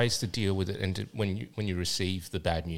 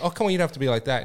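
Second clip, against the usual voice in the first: fast, higher imitation of the pleading man; pleading guy; 6 semitones higher, much faster than usual. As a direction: rush it like a guy begging her to reconsider. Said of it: "he's like".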